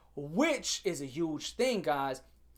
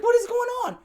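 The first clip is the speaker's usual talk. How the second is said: loud, very high cry of disbelief; bewildered outburst; 6 dB louder than usual. Retold or imitated. Student baffled at being disbelieved, high exasperated outburst. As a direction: exclaim it high, as if nothing makes sense.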